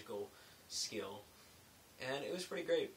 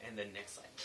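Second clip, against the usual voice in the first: ordinary talk, nothing marked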